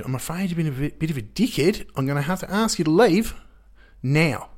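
high mock New Zealand woman; strong New Zealand accent. New Zealand hostel worker throwing out a guest, strong accent. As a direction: put on her broad, high-pitched New Zealand accent. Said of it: "that real strong accent".

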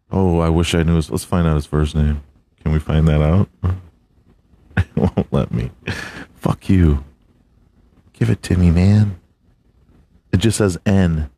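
ordinary talk

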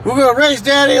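put-on high-pitched excited kid voice; insistent child. Son telling Daddy about the race, imitated high insistence. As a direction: imitate a little boy insisting.

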